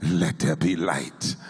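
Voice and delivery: voice of God. Retold or imitated. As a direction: give God's words slowly and with weight. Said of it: "And God said".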